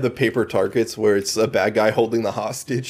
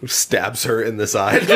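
normal talking voice

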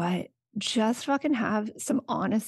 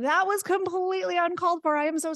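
put-on a lightly higher, earnest sorry to the kids; mild earnest apology voice; sincere apology; 8 semitones higher than usual. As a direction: apologize sincerely, slightly lifted. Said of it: "And I'm like".

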